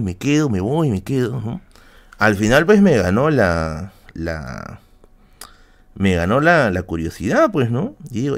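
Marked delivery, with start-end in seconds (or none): none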